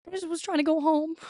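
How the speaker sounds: high, plaintive little voice; mock meek excuse. Retold meek excuse to parents.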